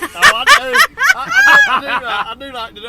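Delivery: high, loud laughing protest. Real, not acted. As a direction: laugh it out, caught out. Caught out about food posts.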